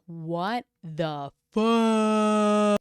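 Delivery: loud, hugely drawn-out imitation of shock; hammed-up outrage, slow and loud; mock stunned disbelief. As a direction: stretch every word in exaggerated disbelief. Acts out Kirat's shock at news.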